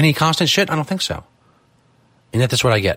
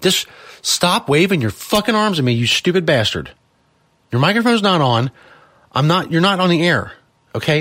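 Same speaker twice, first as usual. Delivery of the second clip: raised, irritated telling-off; angry scolding; 3 semitones higher than usual. Real, not acted. Host telling off Saint Peter for waving, angry. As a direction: snap at someone who keeps interrupting.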